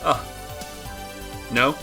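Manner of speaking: normal talking voice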